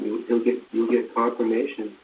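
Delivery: ordinary talk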